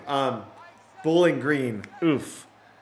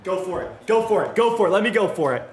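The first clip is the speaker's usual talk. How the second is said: from calm to loud, higher chant; building urge; 5 semitones higher, 4 dB louder, faster than usual. Real, not acted. Gamer hyping himself to go for it, building chant. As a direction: pump yourself up, getting louder each time.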